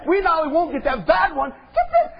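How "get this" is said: "get this" leaps far higher in an excited squeal. Real, not acted.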